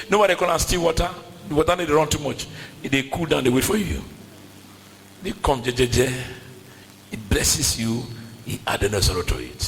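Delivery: normal talking voice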